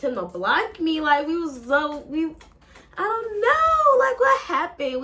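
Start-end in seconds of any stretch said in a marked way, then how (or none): 3.0-5.0 higher, louder bewildered plea. Real, not acted. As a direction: ask it in real disbelief. Confused about being blocked.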